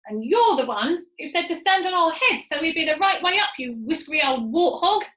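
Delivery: loud furious scream. Acted. Missus Twit screams insults back at her husband. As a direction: insult him at full voice. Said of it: "screamed Missus Twit".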